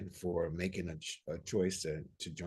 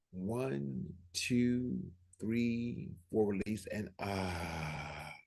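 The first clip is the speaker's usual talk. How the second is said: guided breathing. Acted. Pastor leading the congregation in breathing, calm counting. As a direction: guide the group calmly through the breath.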